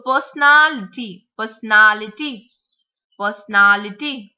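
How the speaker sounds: PERSONALITY as per-so-NAL-ity, NAL loud and long; third-syllable stress. Shown as a demonstration. Teacher modelling five syllabic words, third syllable stressed. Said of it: "the third syllable has to be stressed".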